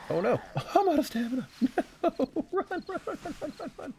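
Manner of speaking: panicked high-pitched urging to run; frantic, fleeing for your life; being chased. Real, not acted. Fleeing zombies, out of stamina.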